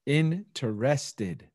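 very slow, syllable by syllable; drawn-out echo. Acted. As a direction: stretch out every syllable of the word. Drawn-out echo of cohost's word.

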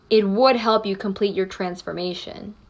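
ordinary talk, nothing marked

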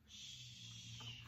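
soft whispered shush; hushing shh. Acted. Teacher hushing the class near the shark. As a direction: hush the children quietly.

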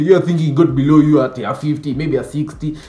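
normal talking voice

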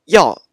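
药 called out loud and high, said as 呀; dialect sound demo. Shown as a demonstration. Teacher showing a checked-tone sound to students, said loud. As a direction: belt out 药 to show it sounds like 呀. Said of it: "药店的药就是呀".

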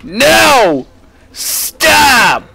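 high, exasperated yell; two high shouts of real frustration; mad. Real, not acted. Player at enemies that just won't stop, mad yelling. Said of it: "getting mad".

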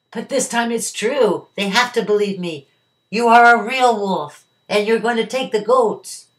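high, loud desperate plea; frightened pleading. A narrator's reading. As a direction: beg like a scared boy up a tree.

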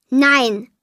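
curt, defiant no; flat refusal. A narrator's reading. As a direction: shut him down like a stubborn kid. Child refuses father again.

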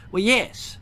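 a quick high goblin answer; Gum Goblin voice. Acted. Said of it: "replied the Gum Goblin".

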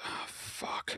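very soft, voiceless sigh; retold dismayed reaction. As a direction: let it out under your breath, imitating sinking dismay. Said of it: "literally my first reaction is".

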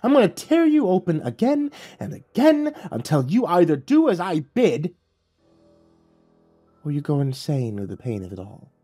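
a demon losing patience; lightly voiced furious devil, menacing; angry devil. Lucifer threatens torture.

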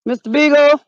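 calling the dog. Pretend. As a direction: call twice into the woods.

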